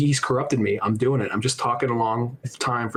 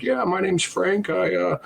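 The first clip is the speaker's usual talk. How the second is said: put-on higher, slow old man introducing himself; drawn-out, raised-pitch old man impression; imitating old man; 6 semitones higher, slower than usual. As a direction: draw out a mock introduction. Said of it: "He's just like".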